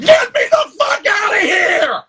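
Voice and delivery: high, loud furious shout of a bound man; furious yell. Retold or imitated. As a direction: scream it, desperate to get free. Retold movie character flipping out.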